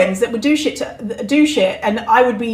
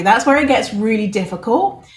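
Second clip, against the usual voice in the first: ordinary talk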